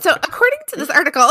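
high, fast, hammed-up newsreader; "according to this article" in a mock reporter voice. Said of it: "your reporter voice".

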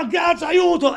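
high, loud panicked cry for help; grido d'aiuto. Acted. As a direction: yell it like you're in danger. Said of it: "è come se uno dice". (urlo disperato)

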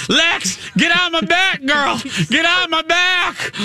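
panicked shout across the water; shouted plea. Retold or imitated. Yelling to sinking friend in water.

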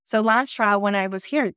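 usual speaking voice

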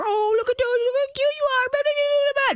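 gushing visitor. Retold or imitated. Visitor gushing over a cute dog, high squeaky baby talk. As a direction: coo over the dog like an overexcited guest. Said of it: "like when you get company that comes over".

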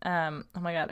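usual speaking voice

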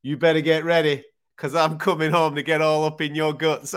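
loud, cocky call to his wife; quoting Derek. Retold or imitated. Lewis shouting out his wife on the mic, loud and cocky. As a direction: boom it like a cheeky promise. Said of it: "and he goes".

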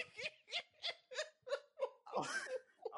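squealing disbelieving laugh; high laughing fit. Real, not acted. Disbelieving coworker's claim.

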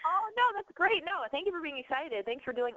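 stuffy nose from a cold; nasal voice. Real, not acted. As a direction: talk through a blocked nose. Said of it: "I sound really nasally 'cause I, I'm sick".

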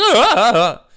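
loud, high cracking wail; hammed-up botched first note; exaggerated voice crack. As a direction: let out a huge mock cracked note. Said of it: "my voice cracks on the very first note".

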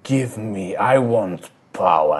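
slow, deliberate shaman voice. Acted. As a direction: demand it slowly as a shaman. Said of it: "The shaman looks, and he".

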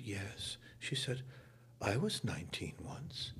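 soft. Retold or imitated. Older star reassuring young actor.

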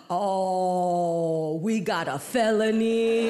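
long, drawn-out low announcement; slow, stretched-out mock triumph; excited cop. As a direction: savor the verdict like a game show host. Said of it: "He's all excited".

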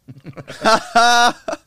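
loud giddy laugh; high burst of laughter. Real, not acted. Laughs at memory.

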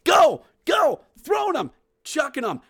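high, excited yells; wild, high-pitched cheering; frantic throwing shouts. Pretend. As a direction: shout as you hurl things one after another. Frantically throwing teddy bears.

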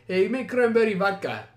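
put-on Armenian accent sliding into Spanish. Date ordering at the bar, muddled Armenian-Spanish accent. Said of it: "the accent goes from Armenian to fucking Spanish".

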